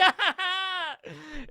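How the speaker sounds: high-pitched hammed-up villain cackle; villain laugh. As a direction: cackle high in mock triumph. Orochi gloats after the big reveal.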